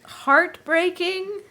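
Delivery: high, drawn-out questioning repeat; slow, doubtful query; incredulous echo. Real, not acted. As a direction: stretch it out in disbelief.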